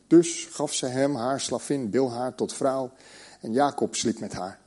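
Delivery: usual speaking voice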